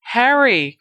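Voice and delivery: loud, high-pitched call of alarm; desperate cry. A narrator's reading. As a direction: cry out the name across a battle. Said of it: "cried a voice".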